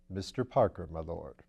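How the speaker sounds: a composed valet answering his master; Bunter the valet. Acted. Bunter announces visitor.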